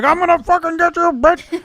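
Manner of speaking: high, loud cartoon rage; mock cussing. Retelling a maimed fighter's threat, mock high-pitched cussing. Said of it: "he's still, like, cussing her out".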